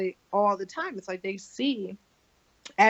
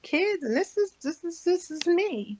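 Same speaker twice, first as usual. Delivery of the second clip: high silly voice; 9 semitones higher than usual. Acted. Showing herself at home.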